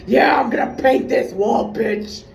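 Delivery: loud, pumped-up shout; aggressive shout. Pretend. Vlogger pretending to vent her aggression on painting, shouting. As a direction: yell it like you're attacking the wall. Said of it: "like, take my aggression out".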